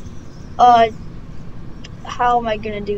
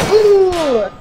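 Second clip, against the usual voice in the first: spooky ooh; 7 semitones higher, 6 dB louder than usual. Acted. Ghost spooking the children.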